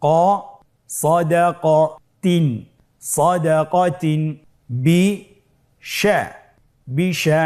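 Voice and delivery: slow syllable-by-syllable chant; measured build-up drill for learners to repeat; broken-down reading. Shown as a demonstration. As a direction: give each syllable alone, then join them up. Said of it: "ভেঙে ভেঙে পড়ব".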